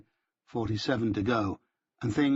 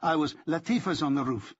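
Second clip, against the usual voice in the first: higher-pitched, mildly irritated retort; snapped; 5 semitones higher than usual. A narrator's reading. Benjamin retorting to his comrade, a mild snap. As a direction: snap it with a touch of anger. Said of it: "he snapped".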